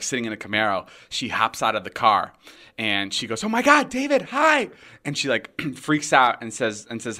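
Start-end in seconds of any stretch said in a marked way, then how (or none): 3.4-4.7 high-pitched, gushing fan squeal imitation; excited greeting. Girl greeting David outside her car, excited. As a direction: imitate someone thrilled to spot him. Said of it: "being excited to see me".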